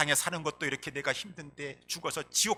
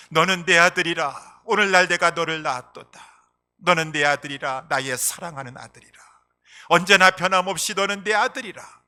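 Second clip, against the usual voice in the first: loud, high declaration of hymn words; proclaimed lyrics; 4 semitones higher, 16 dB louder than usual. Acted. Preacher reciting the boy's hymn, loud declaration. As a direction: proclaim the hymn as God's promise.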